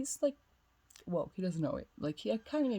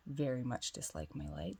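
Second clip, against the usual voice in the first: ordinary talk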